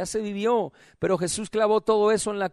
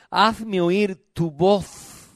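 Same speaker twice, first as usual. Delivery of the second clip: solemn verse reading; much slower than usual. Real, not acted. Preacher reading verse fourteen to congregation, solemn verse reading. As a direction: draw out each word of the verse.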